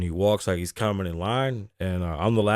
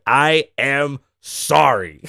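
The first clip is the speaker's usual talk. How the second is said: loud, slow, higher-pitched yell; mock screamed apology; 4 semitones higher, 7 dB louder, much slower than usual. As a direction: draw out each word in a mock shout. Repeats the man's screamed apology.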